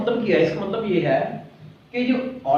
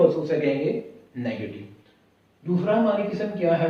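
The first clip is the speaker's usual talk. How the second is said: ordinary talk, nothing marked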